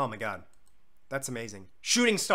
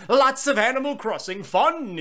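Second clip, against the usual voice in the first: silly put-on announcer voice; a goofy hyped-up promo voice; 7 semitones higher, 7 dB louder than usual. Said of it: "I don't know why I did that voice".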